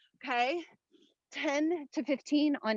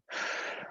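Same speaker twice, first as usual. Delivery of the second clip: effortful sigh; 12 dB quieter than usual. Real, not acted. Mid-workout effort exhale.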